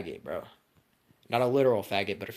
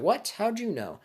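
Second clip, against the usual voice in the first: much higher, startled question; stunned surprise; 13 semitones higher, 5 dB louder than usual. Retold or imitated. Sasuke startled by Austin's secret, much higher. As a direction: gasp it out, caught off guard.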